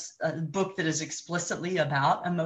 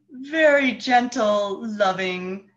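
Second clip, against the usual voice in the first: gentle loving voice; 5 semitones higher, 5 dB louder, slower than usual. Shown as a demonstration. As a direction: sound tender and kind. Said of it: "I wonder how the".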